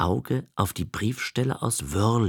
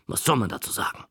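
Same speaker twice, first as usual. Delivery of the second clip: puzzled aside; much faster than usual. Shown as a demonstration. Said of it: "Da ich auch nicht wusste, was man dazu sagen sollte".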